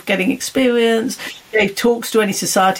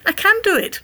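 ordinary talk

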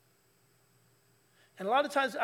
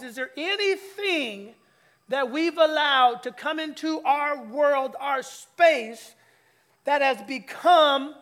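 slow, louder preaching, pressing each phrase; preaching build; 3 semitones higher, 4 dB louder, slower than usual. Acted. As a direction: pastor pressing the congregation about idols, slow and louder.

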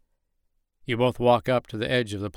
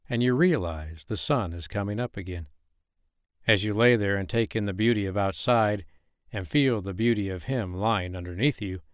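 ordinary delivery

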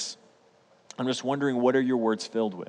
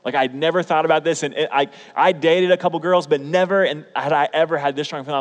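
much louder and higher, gushing; excited; 5 semitones higher, 7 dB louder than usual. Real, not acted. Preacher telling how he knew he'd marry, excited. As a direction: pour it out with rising excitement.

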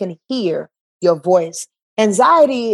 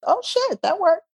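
high squeal of amazed disbelief; amazed oh shit; 12 semitones higher than usual. Retold or imitated. You, stunned the store trip paid off, squealing high. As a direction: jump way up in pitch, stunned it worked.

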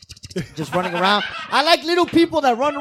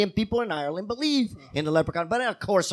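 ordinary delivery

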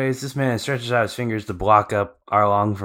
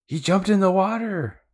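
much higher, loud call from afar; shout; 12 semitones higher, 4 dB louder than usual. Retold or imitated. Nami warning Luffy from the distance, high shout. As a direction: yell it out across the distance. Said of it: "she kinda shouts".

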